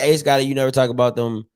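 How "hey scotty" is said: usual speaking voice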